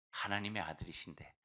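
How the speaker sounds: ordinary talk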